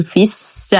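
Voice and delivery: في الس as "fis-sa", short i into doubled س; dropped long vowel. Shown as a demonstration. Teacher modelling the join, short "fis" in في الس. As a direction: shorten في straight onto the hissed س of الس. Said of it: "يحذف منعا من التقاء الساكنين، فنقول".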